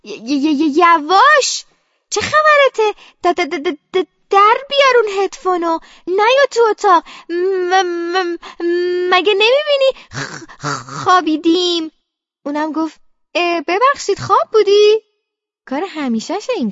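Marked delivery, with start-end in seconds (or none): 0.0-11.9 loud, high yell stuck on first sounds; furious stuttered scolding; stammering shout. Acted. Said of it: "منم داد زدم". 13.3-15.0 loud, high-pitched apology; rising, raised-voice question from a clueless brother. Acted. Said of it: "همهٔ کارش با صدای بلنده".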